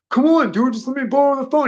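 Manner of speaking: high, loud pleading imitation; mock begging. Begging the guy for a phone, mock high plea. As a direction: mimic a man whining to borrow something. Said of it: "He was begging the guy for a phone".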